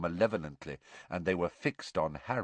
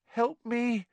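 high, thin pleading moan; frightened moan. A narrator's reading. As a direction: moan for help in high-pitched fear. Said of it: "he moaned to Hermione".

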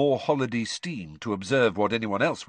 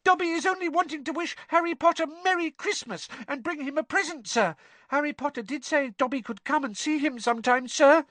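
much higher squeaky elf voice, nervous; anxious squeak. A narrator's reading. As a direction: squeak it like a fretful house-elf. Dobby keeps talking.

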